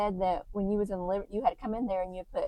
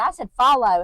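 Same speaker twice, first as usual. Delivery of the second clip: insistent; 3 semitones higher, 8 dB louder than usual. Retold or imitated.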